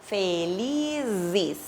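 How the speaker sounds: "Felizes" with a buzzing voiced "z" and a final "zi"; z sonoro em "Felizes". Shown as a demonstration. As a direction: stretch the "z" in "Felizes" so it vibrates. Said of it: "portanto ganha vibração".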